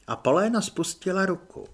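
plain reading voice

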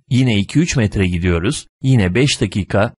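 plain reading voice

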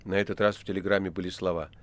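usual speaking voice

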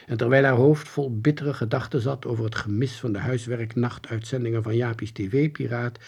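usual speaking voice

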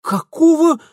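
high, loud startled question; bristling retort. A narrator's reading. Mitya flares up at the old woman's orders, indignant question. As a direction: flare up at the remark. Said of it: "вскинулся Митя".

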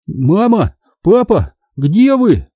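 high excited calls; calling out. A narrator's reading. Boy calls parents to see the comic, excited high calls. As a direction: shout for mom and dad to come look.

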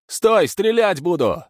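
high, loud, slow command; shouting threat. A narrator's reading. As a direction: bellow a warning to stop. Stas chasing, threatening.

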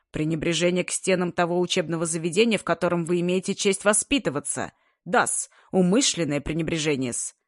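plain reading voice

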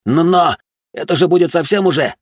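high, stammering objection; flustered shouted protest. Acted. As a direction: protest loudly to the boss. Assistant protesting in shouted quarrel.

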